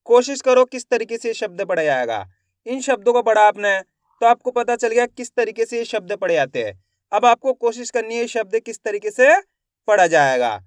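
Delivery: ordinary talk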